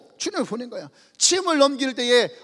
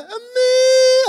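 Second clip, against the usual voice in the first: put-on high, loud, drawn-out child's wail; crying child; 13 semitones higher, 8 dB louder, much slower than usual. Fallen child in a TV ad crying for his mother. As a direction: wail for mom like a hurt kid. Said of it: "하고 막 울어요".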